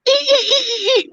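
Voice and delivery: very high squeaky ee-ee noise; rubber chicken squeak. Retold or imitated. Pranking Tim with a rubber chicken, a very high squeak.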